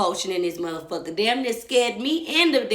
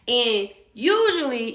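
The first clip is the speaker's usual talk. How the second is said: ordinary delivery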